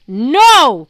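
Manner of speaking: very loud bellow; titan bellowing in fury. A narrator's reading. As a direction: roar the refusal. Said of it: "Kronos bellowed".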